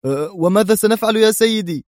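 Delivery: high-pitched, uneasy aide; anxious subordinate. A narrator's reading. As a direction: ask the boss in a high, worried voice. Aide anxiously asking his superior.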